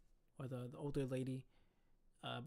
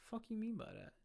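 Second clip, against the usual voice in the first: higher, confrontational fake-offended snap; incredulous retort; 7 semitones higher, 5 dB quieter than usual. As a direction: act shocked and ready to fight. Retorting to the lady's odd remark.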